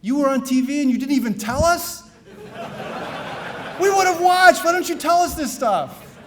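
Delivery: high, loud, laughing exclamation; excited brother. Retold or imitated. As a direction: burst out in delighted disbelief. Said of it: "the first thing he said to me is".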